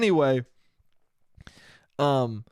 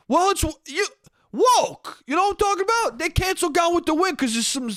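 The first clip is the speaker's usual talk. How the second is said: mock flustered shout; 11 semitones higher than usual. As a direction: bluster back after being corrected. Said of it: "And then goes".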